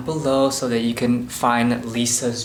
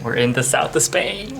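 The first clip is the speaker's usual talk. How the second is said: excited announcement, louder at the end; 2 semitones higher, 4 dB louder than usual. Real, not acted. Traveler telling viewers they made it to Spain, excited. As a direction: announce the arrival with building excitement. Excited arrival, first take.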